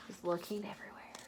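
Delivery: breathy whisper to the listener; whispered aside. Shown as a demonstration. Storyteller whispering an aside to the child. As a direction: lean in and whisper it. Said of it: "whispered aside to listener".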